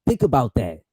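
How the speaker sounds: ordinary delivery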